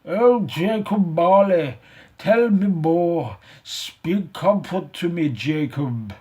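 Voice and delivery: loud, slow desperate plea; imploring. Acted. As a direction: beg Jacob for comfort. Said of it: "he said imploringly".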